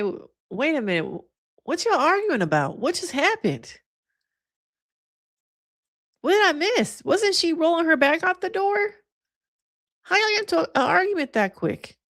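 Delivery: high-pitched, incredulous questioning; mock bewilderment. Host replays her reaction to the awkward argument, high mock bewilderment. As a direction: act out baffled disbelief, pitch climbing way up.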